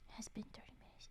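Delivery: hushed whisper to chat. Real, not acted. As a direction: drop to a whisper. Quiet aside to chat.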